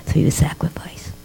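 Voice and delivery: whispered, voice dropped to almost nothing; hushed whisper. Retold or imitated. Rebbetzin whispering to the bandaged soldier, hushed. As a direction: breathe it out reverently.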